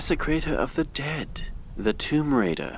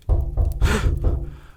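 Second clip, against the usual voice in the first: frightened gasp; 10 semitones higher, 4 dB louder than usual. Acted. Frightened by knocking at night.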